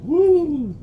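high, loud woo; excited whoop. Real, not acted. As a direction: cheer it out. Excited cheer on camera.

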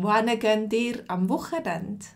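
ordinary talk, nothing marked

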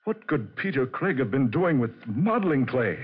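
baffled and uneasy; rising agitation; alarmed bewilderment. Acted. Bewildered by the clay and wires.

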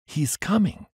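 high joyous exclamation; joyous cry. Acted. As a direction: cry out with sudden joy. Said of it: "I cried out joyously".

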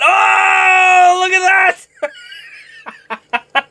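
real excited outcry; excited shout. Real, not acted. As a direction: yelp at the card. Another big pull.

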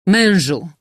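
crying out. A narrator's reading. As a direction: call out urgently to your husband. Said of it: "krzyknęła".

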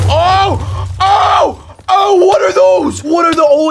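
high, loud startled cries; alarmed shouts. Real, not acted. Gamer sees a castle of mobs, alarmed shouts. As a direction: yell in alarm.

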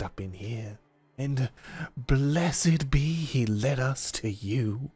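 slow, relishing drawl with stretched words; folksy menacing stranger. Acted. Game master as stranger eyeing fresh meat, drawling menace. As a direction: savor the threat like a backwoods preacher.

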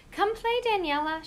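high, bright-voiced child friend calling out; little girl calling. Evelyn calls across to Daniela, high and eager. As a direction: sing it out like a kid inviting a friend over.

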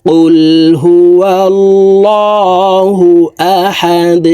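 slow, drawn-out melodic tilawat; Quranic recitation. Acted. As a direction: intone it slowly like a reciter. Recites the verse. (ধীর সুরেলা তিলাওয়াত)

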